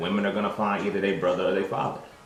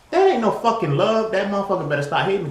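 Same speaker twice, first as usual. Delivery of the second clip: high and loud indignant rant, speeding up; outraged; 10 semitones higher, 5 dB louder than usual. Real, not acted. Rejecting his sister's love-tap excuse, loud indignant protest.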